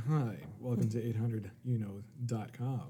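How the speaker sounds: low, soft customer service greeting; phone voice. Pretend. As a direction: answer like a call center. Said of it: "your phone voice".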